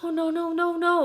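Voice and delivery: high, rising panicked no's; replaying her panic to the viewers; panicking. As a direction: plead it out in a rush of panic. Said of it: "like, panicking".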